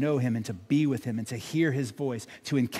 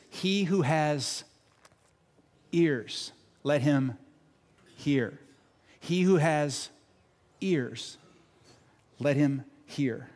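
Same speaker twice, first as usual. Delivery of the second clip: slow, with long pauses between phrases; measured scripture quote, heavily spaced; deliberate quotation; slower than usual. Retold or imitated. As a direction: let each piece of the saying land before the next. Quoting Jesus's repeated phrase deliberately.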